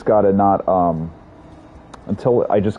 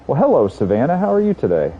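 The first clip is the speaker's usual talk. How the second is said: smarmy, higher salesman greeting; sleazy salesman voice; 8 semitones higher than usual. Acted. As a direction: oily pitch to a customer. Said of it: "Why am I talking in my sleazy salesman voice?".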